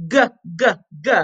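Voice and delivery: "G" as a hard guh, not the letter name; short separate guh sounds for each "G". Shown as a demonstration. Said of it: "what's the G sound".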